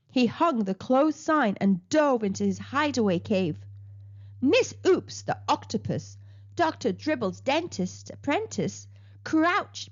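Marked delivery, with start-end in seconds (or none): none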